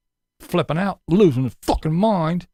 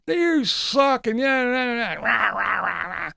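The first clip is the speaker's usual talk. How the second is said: high, mocking rant fading into rah rah; hammed-up tantrum that trails off; mock ranting Tater; 7 semitones higher, slower than usual. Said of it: "That's how Tater was".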